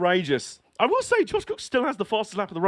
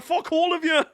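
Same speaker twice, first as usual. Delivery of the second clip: mock fury; 9 semitones higher than usual. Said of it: "What's like".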